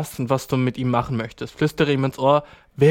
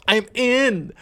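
mock triumph; 7 semitones higher than usual. Acted inner triumph during massage.

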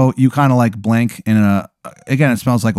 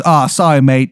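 put-on unconvincing English accent; 3 semitones higher, 3 dB louder than usual. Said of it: "his accent isn't convincing at all".

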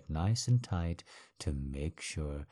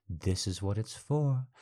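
ordinary talk, nothing marked

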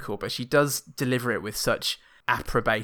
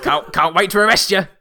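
mock police threat; 7 semitones higher, 8 dB louder than usual. Police mocking threat, quoted.

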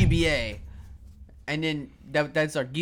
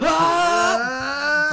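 anime power-up yell; 12 semitones higher, 8 dB louder than usual. Pretend.

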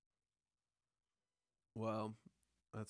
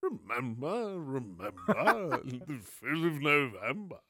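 theatrical masked villain, laughing through it; hammed-up dramatic recital; V for Vendetta voice; 3 dB louder than usual. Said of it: "what his voice sounds like".

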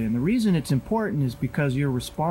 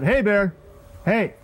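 loud, high warning shout; shouting to scare off a bear; 8 semitones higher, 5 dB louder, slower than usual. Shown as a demonstration. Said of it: "shouted out".

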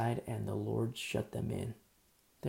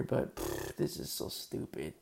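scoffing; 9 semitones lower than usual. Acted. Mocker scoffing at the boat.

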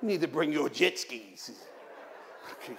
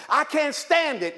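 exasperated complaint; 8 semitones higher, 3 dB louder, slower than usual. Retold or imitated. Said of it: "something that we often say".

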